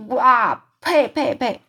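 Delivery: loud, high disgusted spitting; loud spitting yell. Acted. Giant spitting out the vile snozzcumber, loud disgusted yell. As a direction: spit the food out with a roar. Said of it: "就是大声地嚷嚷着".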